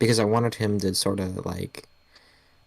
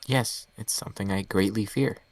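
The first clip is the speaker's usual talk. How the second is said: ordinary talk, nothing marked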